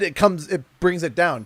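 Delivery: ordinary talk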